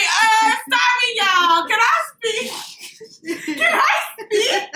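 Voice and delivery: high, drawn-out pleading call; mock permission-seeking. Co-host imagining a recruit asking to speak, high drawn-out mock plea. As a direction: stretch the question out high, half laughing.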